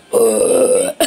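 low belch breaking into the sentence; interrupting burp. Acted. Bert cut off while explaining, low burp. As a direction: burp helplessly mid-sentence. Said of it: "I can't stop".

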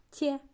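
тє with a softened т; soft тє. Shown as a demonstration. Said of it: "як вимовляється твердість і м'якість".

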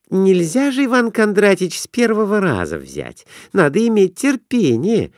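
put-on high, girlish voice; eager wife asking to place a bet; young wife's voice. A narrator's reading. Wife advising merchant.

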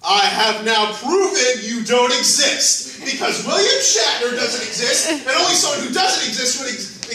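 high, loud, hammed-up declaration; manic rant. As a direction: prove it like a mad genius. Ranting proof he doesn't exist.

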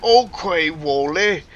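high, loud mock Scottish accent; thick Scottish brogue. Sentient sword naming its owner, thick Scottish brogue. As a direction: put on a broad comic Scottish brogue. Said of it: "thick Scottish brogue".

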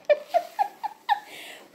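sudden high-pitched laugh; high burst of laughter. Real, not acted. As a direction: break into a squealing laugh. Laughs at her own joke.

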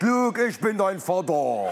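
slow Vader line in dialect. Retold or imitated. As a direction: say it in broad German dialect. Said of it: "Und dann noch auf Dialekt".

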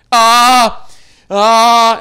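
loud, high drawn-out "ah"; tongue-tied wail, very loud; stuck, wordless moan at full volume; flustered noise. Retold or imitated. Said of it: "these are the noises I was making".

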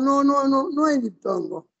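usual speaking voice

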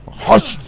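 sharp, high battle cry haç; a quick yelp as if swinging a weapon; mock strike cry. Gamer voicing his hit on the enemy, a sharp high cry. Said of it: "Horç diye vuracağım bunu".

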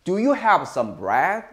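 slow, deliberate model question; slow model sentence. Shown as a demonstration. Teacher slowly reading the sentence for students to repeat. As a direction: say it slowly so students can follow. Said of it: "계속 따라하세요".

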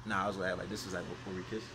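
hushed. Real, not acted. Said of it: "whispered walk-through before kiss".

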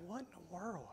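barely audible, under the breath; bewildered mutter. Retold or imitated. Boyfriend driving his silent girlfriend home, bewildered murmur. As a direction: murmur it to yourself in disbelief.

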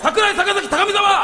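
calling names. Acted.

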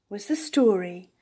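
usual speaking voice

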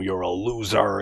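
ordinary delivery

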